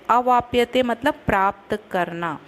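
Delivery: usual speaking voice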